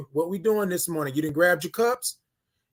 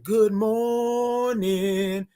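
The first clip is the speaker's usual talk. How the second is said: stretched way out on a higher pitch; very slow, held-out morning call; drawn-out greeting; 4 semitones higher, much slower than usual. Shown as a demonstration. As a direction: draw MORNING out long and high. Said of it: "Y'all gotta say it like that".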